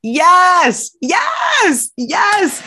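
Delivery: loud, high cheering yells; excited shouting. Real, not acted. Cohost cheering the rhino line with excited shouts. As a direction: cheer it like a win.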